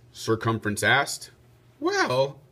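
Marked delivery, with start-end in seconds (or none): 1.8-2.4 Lady Di's voice. Acted. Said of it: "said Lady Di".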